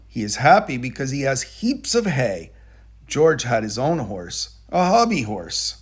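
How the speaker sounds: plain reading voice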